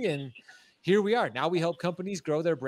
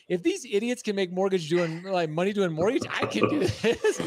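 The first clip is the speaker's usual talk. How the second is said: a half-laughing inner voice, higher and louder; laughing disbelief; 5 semitones higher, 3 dB louder than usual. Retold or imitated. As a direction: chuckle through it like it's too easy. Self-quote realizing he can succeed.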